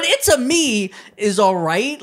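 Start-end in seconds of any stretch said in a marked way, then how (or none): 0.0-0.9 high cartoon Italian voice; playful video game plumber line; Mario catchphrase. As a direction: chirp it like Mario. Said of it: "but, but".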